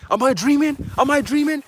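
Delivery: high, loud incredulous cries; bugging out. Retold or imitated. Mom jumping up at the news, loudly incredulous. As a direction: imitate mom wildly doubting her senses. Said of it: "She's bugging the fuck out".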